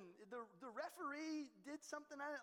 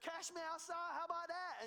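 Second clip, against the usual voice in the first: higher, louder mock taunt; mock cocky attitude; 6 semitones higher, 4 dB louder than usual. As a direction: toss it off like a cheeky challenge. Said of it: "cock an attitude".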